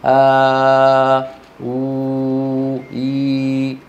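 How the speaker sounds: long aa, oo, ee held on a level tone; effortless long vowels. Shown as a demonstration. Teacher shows students that long vowels flow without effort, sustained and effortless. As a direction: draw out آ، أو، إي smoothly, without effort. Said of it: "من غير كلفة على اللسان".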